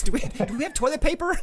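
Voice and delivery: mock question breaking into laughter; laughing joke. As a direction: ask it cheekily, laughing. Jokey mock request to wife.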